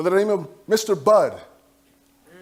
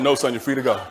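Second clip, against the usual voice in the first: lower, dry police officer; a hint of a gruff cop; 9 semitones lower than usual.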